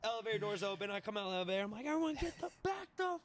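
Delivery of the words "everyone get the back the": retold freak-out. Drunk man stepping out of the elevator, mock freak-out. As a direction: act out a frantic order to clear space, stretched and high. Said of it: "I'm freaking out".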